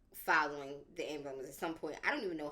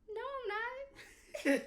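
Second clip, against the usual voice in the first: very high, drawn-out squeak of disbelief; high-pitched denial; much slower than usual. Retold or imitated. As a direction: squeal a slow, retold denial. Retold disbelief at stroke news.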